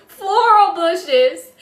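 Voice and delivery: slow, loud, laughing through the words. Real, not acted. Host laughs off her tree bush slip, laughing. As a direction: laugh at yourself as you correct it.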